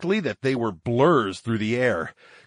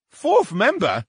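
high, indignant squawk; squawking brain voice; 14 semitones higher, 4 dB louder than usual. Acted. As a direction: screech the question, offended. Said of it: "A B squawked".

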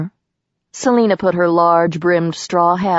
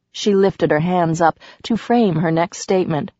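ordinary delivery

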